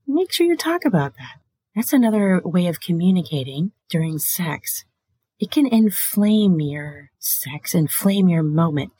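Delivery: usual speaking voice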